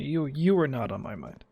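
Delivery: usual speaking voice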